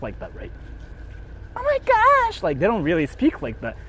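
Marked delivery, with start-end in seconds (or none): 1.6-2.4 much higher falsetto imitation; mock a guy straining to sound girly; guys faking a girl voice. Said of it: "they try to sound like this".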